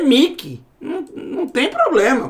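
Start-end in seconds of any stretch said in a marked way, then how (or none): 0.0-0.6 "Mickey" as "Mii-ki", ee vowel; Portuguese reading. Shown as a demonstration. Teacher reading the name as Portuguese, plain i vowel. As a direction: read "Mickey" just as a Portuguese speller would. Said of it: "se é português, eu vou ler".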